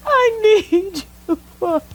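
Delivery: high, loud, broken sobbing pleas to God; sobbing prayer. Real, not acted. Woman crying out to God for forgiveness, sobbing hard. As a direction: weep out every word, begging forgiveness.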